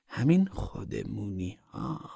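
soft, very slow echo; hushed repeat. A narrator's reading. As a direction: drop the volume and stretch خودمونی meaningfully. Woman repeats it, dropped low. (تکرار آهسته و کشیده)

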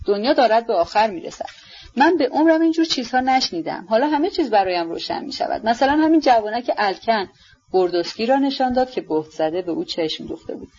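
ordinary delivery, nothing marked

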